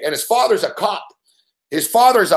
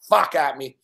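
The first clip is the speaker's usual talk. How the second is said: ordinary talk, nothing marked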